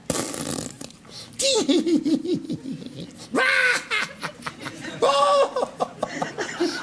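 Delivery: big high shout of laughter; loud laughing shout. Real, not acted. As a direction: burst out in a huge laugh. Hypnotist laughing at subject.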